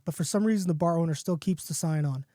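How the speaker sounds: plain reading voice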